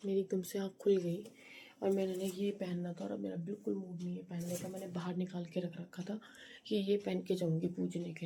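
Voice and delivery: soft and low-key, keeping quiet so nobody wakes; hushed early-morning voice. Real, not acted. Mother vlogging at dawn while family sleeps, hushed. Talks softly, family asleep.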